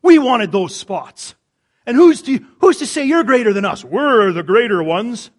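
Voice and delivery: hammed-up disciples griping at each other; jealous disciples. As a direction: squabble like rivals who lost the best seats. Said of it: "They said".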